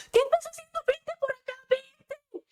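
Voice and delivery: very high-pitched mock scream at a child; panicked parent's shriek, held back in volume; acted yelling. Mother to daughter running into the street, mock yelling. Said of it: "Vamos a gritarle".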